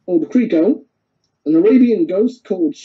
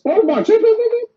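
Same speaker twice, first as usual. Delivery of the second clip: mock alarm; 13 semitones higher, faster than usual. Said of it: "and goes".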